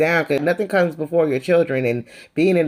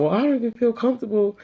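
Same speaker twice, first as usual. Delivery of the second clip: mocking Lisa; 5 semitones higher than usual. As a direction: imitate her self-pitying sigh. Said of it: "talking about".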